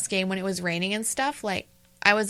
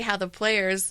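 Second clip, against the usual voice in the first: usual speaking voice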